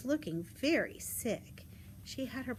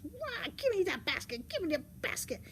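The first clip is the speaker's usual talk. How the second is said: high, frantic wolf demand; hurried, high-pitched storybook wolf; grabbing wolf; 8 semitones higher than usual. Acted. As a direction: demand it as a greedy pouncing wolf. Wolf grabs girl's basket.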